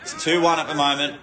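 usual speaking voice

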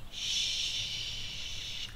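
long, drawn-out secretive "shh"; hushing shh. Acted. Letting children into a little secret, hushing shh.

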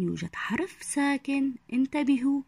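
usual speaking voice

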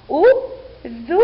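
slow syllables with pauses between; teacher's separated sounding-out. Shown as a demonstration. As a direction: break أذن into u - dhu - nun. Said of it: "لنقرأ".

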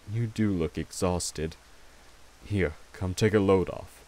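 ordinary talk, nothing marked

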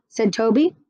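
usual speaking voice

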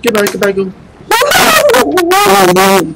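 high, loud frightened shriek; panicked scream. Real, not acted. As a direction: scream while fleeing in terror. Monster chasing him.

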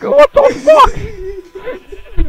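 high, drawn-out laughing outburst; laughing disbelief. Retold or imitated. Retelling his reaction to that one noise, laughing disbelief. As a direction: stretch it out through squealing laughter.

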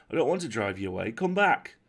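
quick, loud call to someone out on the water; urgent shout to coax them back. Acted. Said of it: "sang out Will".

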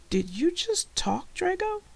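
put-on amazed boy, voice climbing sharply; astonished question. A narrator's reading. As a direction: pitch soaring at the end. Dan amazed his creature talks.

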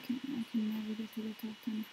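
ordinary delivery

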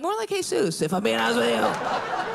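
"Jesus" said Spanish style, like hey-soos. Retold or imitated. Answering the carpentry question with Spanish "Jesus" pronunciation. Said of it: "I'm like".

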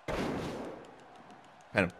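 soft urgent whisper. Real, not acted. Urging the pin.

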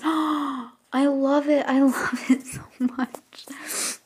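slow, high breathy gush; real smitten gush; gushing whisper. Real, not acted. Gasps, gushing over hair.

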